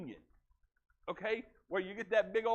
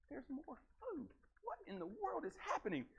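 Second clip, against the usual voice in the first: amazed; 7 semitones higher, 9 dB quieter than usual. Acted. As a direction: sound stunned that the basket is full again. Disciples amazed at refilled basket.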